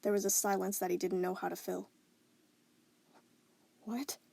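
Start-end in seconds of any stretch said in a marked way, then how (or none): 3.9-4.2 soft, stunned whisper. Acted. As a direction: barely breathe it out. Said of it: "he eventually whispered".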